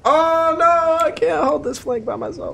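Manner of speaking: high panicked cry; alarmed yelp for help. Real, not acted. Alone holding the flank.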